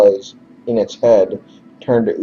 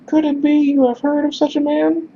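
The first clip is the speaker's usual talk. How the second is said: very high falsetto question; high-pitched, hopeful character. Acted. Messenger hopefully asks stranger for news, high-pitched voice. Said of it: "He looks at you hopefully again".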